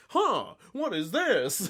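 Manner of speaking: high, slow, clueless wondering; mock bafflement, loud and drawn out; dopey Matthew. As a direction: play a dope puzzling it out. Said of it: "he's just the biggest, dopiest guy".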